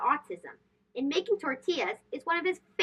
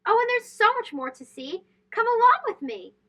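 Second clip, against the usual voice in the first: bright, higher sing-song call to come along; excited invitation; 8 semitones higher, 4 dB louder than usual. A narrator's reading. Narrator calling young listeners onward, bright and excited. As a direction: lift it up like beckoning a child to follow.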